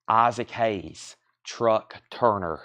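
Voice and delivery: soft, slow, monotone reading. Shown as a demonstration. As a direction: read the title like a sleep app. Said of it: "my very soft monotone voice".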